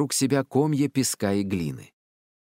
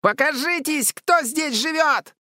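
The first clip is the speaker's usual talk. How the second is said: sudden yell; 5 dB louder than usual. A narrator's reading. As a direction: yell bravely into the woods. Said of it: "вдруг заорал". (внезапный громкий крик)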